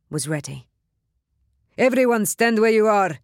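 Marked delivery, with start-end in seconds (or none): 1.8-3.2 loud commanding shout through a mask. Acted. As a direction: bellow the order across the square. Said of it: "he shouted into the night air".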